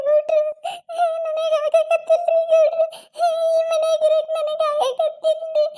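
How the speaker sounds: high, slow tearful wail to her husband; weepy daughter-in-law begging Gowda; crying. Acted. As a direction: sob the words out, high and drawn out. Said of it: "ಯಾಕ್ ಅಳಕತ್ತಿ?".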